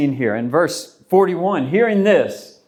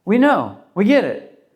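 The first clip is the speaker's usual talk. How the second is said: eager disciples; 5 semitones higher than usual. Retold or imitated. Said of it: "the disciples would have said".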